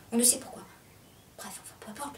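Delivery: trailing into a whisper; faint, halting murmur; hushed. Acted. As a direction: let it fade to almost nothing. Monologue runs down.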